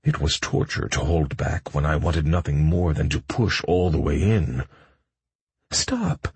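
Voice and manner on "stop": high plea. A narrator's reading. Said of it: "she repeated".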